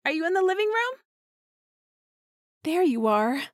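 high, loud and eager; excited greeting. Acted. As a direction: call out happily on arriving home. Cheerful homecoming greeting to partner.

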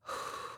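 soft exhale before gearing up; real, quiet breathy sigh. Real, not acted. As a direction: let out a low breath. Gearing up to record.

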